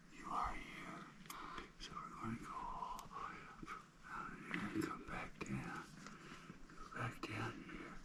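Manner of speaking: hushed cave whisper. Real, not acted. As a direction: keep it to a whisper, in awe. Whispering through the cave tour.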